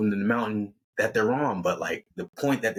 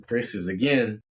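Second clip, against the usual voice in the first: usual speaking voice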